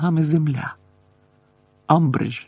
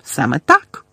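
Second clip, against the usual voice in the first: shriller still; 4 dB louder than usual. A narrator's reading. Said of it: "ще пронизливішим голосом".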